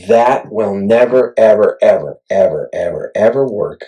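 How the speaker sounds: emphatic never ever ever. Real, not acted. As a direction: hammer home that it won't work. Insisting the Hohem won't work.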